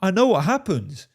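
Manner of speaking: high, strained, sarcastic; sarcastic inner voice. Retold or imitated. His unspoken reply to her, high strained sarcasm. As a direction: sneer it to yourself, fed up.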